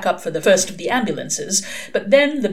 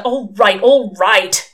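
loud, irritated cut-off; exasperated interruption; snapped; 3 semitones higher, 8 dB louder, slower than usual. A narrator's reading. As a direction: cut in testily to silence the other. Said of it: "Crowley snapped".

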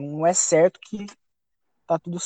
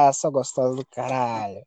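low, softer mock insult; joking taunt; 8 semitones lower, 5 dB quieter than usual. Player only teasing his teammate at first, low joking taunt. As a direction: toss out the insult as a lazy tease. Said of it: "no começo eu tava zoando".

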